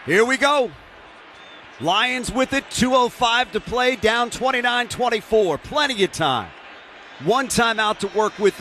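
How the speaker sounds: radio play-by-play announcer. Real, not acted. Live play-by-play of the drive.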